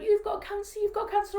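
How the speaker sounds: loud, very high imitation of an upset daughter; acted distraught daughter. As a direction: wail the words as the daughter, retold.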